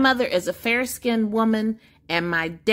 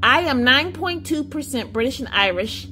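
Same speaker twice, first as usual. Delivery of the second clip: usual speaking voice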